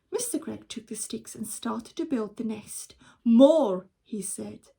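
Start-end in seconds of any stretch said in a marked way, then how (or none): 3.2-3.9 loud, firm demand. Acted. Father demanding more sticks, loud. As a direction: call for more.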